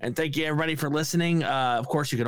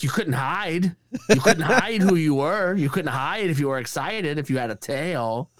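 higher, sing-song star impression; Christopher Walken; 3 semitones higher than usual. Imitating a star explaining tails to a comedian, higher-pitched impression. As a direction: punch the phrase, then ease down. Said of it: "He's like".

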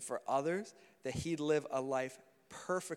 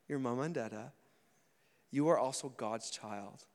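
usual speaking voice

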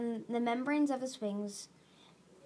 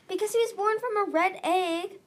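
high, louder, insistent; urgent explanation; 9 semitones higher, 5 dB louder than usual. A narrator's reading. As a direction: explain it in a pressing rush of feeling. Urgent explaining during rescue plea.

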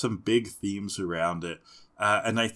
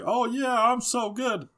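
much higher, smug boast; cocky Ash voice; 12 semitones higher than usual. Retold or imitated. Co-host mimics cocky Ash bragging, in a high smug voice. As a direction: preen like a kid bragging about his wins. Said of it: "He's like".

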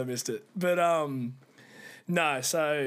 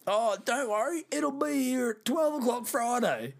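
mock cheery caller; 10 semitones higher, slower than usual. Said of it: "I get a call on Thursday saying".

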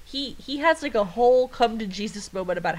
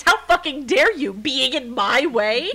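loud, high indignation, slowing at the end; hammed-up outrage; mock angry cat; 4 semitones higher, 6 dB louder than usual. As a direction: play the affronted cat, drawing out the last words. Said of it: "angry at them".

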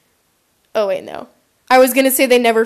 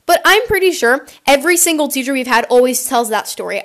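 usual speaking voice

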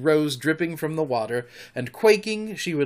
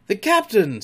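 raised, startled exclamation; loud, high pirate surprise; surprised; 7 semitones higher, 6 dB louder than usual. A narrator's reading. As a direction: blurt it out in astonishment. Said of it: "staring at each other in surprise".

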